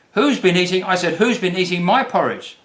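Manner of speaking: a hint of an upset Mama Bear; Mama Bear asking. A narrator's reading. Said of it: "asked Mama Bear".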